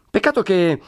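ordinary delivery

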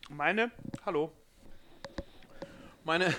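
talking around a bite of food; chewing while talking. Real, not acted. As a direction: mouth full, slow. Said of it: "ich esse grad Pizza".

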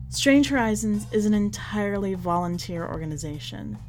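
ordinary delivery, nothing marked

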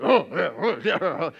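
loud mock grumbling huffs; huffy angry noises. Sulker stomping off down the hall, exaggerated huffing. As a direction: grunt and huff to show you're still mad. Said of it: "we make those noises like".

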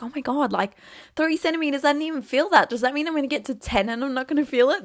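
panicking in your head, retold; rising mock disbelief; inner panic. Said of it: "This is me in my head".

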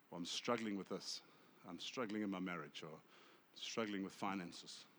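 low, hushed admission of struggle; vulnerable confession. Acted. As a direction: confide softly, asking a friend for help. Acts out vulnerable confession to a friend.

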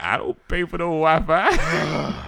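slow, drawn-out disbelieving denial; slow incredulous denial. Retold or imitated. Him denying he pays for the Wi-Fi, slow incredulous. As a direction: deny it slowly in disbelief.

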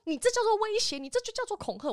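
mock furious mother; imitating angry mother. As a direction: mimic mom's indignant outburst. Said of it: "他说".

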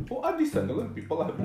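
bad fake Indian accent; a hammy Indian accent. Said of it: "That was the worst Indian accent ever".

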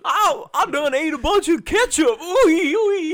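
high loud yokel cry. Acted. Acted firefighter voice, Gotham skit.

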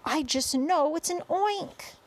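high, drawn-out insistent cry; screaming. A narrator's reading. Head of fire department insists on his guess, high alarmed cry. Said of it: "screamed the head of the fire department".

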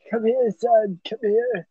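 put-on high old grandpa voice calling his grandson. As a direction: play a doddering grandfather, high and thin. Old selves on deathbed telling grandson.

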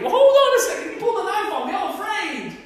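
comic high-pitched objection, half laughing; mock protest. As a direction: squeal it like a joking protest to the verse. Mock reply to scripture.

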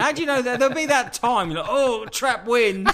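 high, loud mock alarm; mock disbelief. Teasing his friend about his heart pains, high and loud.

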